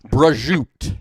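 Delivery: "Prosciutto" as a loud, high "bruh-ZHOOT"; Brooklyn Italian pronunciation. Shown as a demonstration. Demonstrating Brooklyn Italian food words.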